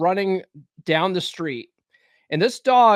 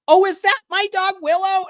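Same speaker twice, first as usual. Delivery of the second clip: high, loud put-on neighbor voice; imitated neighbor; 6 dB louder than usual. Neighbor seeing man chase her dog, high mimicked call. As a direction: mimic the neighbor calling out, pitched way up.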